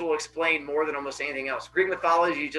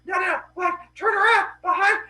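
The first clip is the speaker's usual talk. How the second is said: high-pitched, frantic mock alarm; squeaky, urgent calling out; urgent crowd warning; 13 semitones higher, 5 dB louder, faster than usual. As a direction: imitate onlookers trying to get someone's attention. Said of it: "trying to get her attention".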